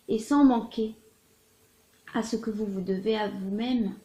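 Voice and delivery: measured, expressive literary reading with swells and pauses; dramatic love-letter reading. Acted. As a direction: read it as an ardent lover's confession. Reads a love letter aloud.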